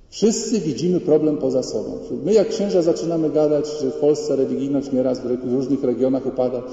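ordinary talk

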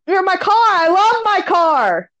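high, stretched-out calling voice; retold yell across. Shopper repeating herself to the lady, retold yell. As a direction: mimic yourself hollering cheerfully over a distance. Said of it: "I'm yelling across".

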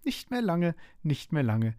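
high start, dropping on the repeat; lightly voiced gloating flower; giggly taunt. A narrator's reading. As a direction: tease in a mild singsong. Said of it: "kicherte die Chrysantheme dazwischen".

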